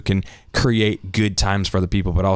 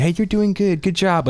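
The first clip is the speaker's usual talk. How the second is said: high, bright mock encouragement; cheesy pep talk; 9 semitones higher than usual. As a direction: parrot an empty "good job" cheer. Generic praise, mock quote.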